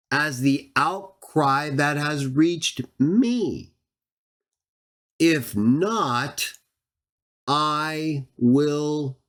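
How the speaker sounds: each phrase drawn out very slowly; slow emphatic rereading. Shown as a demonstration. Teacher rereads the verse to Trinitarians, very slowly. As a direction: reread it slowly, weighing every word. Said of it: "Look at it again".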